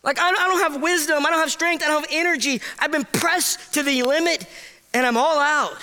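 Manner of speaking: high, urgent outcry of a man at his limit; pleading, high-pitched confession of weakness; desperate realization. Retold or imitated. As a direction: cry out that you've got nothing left. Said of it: "and go".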